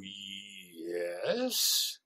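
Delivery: a wary, reluctant "Yes"; guarded yes. Shown as a demonstration. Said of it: "I don't hear you go".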